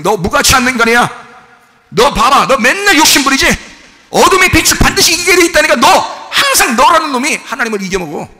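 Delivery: loud, high taunting accuser; accusing devil. Acted. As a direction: jeer at the listener as the devil would.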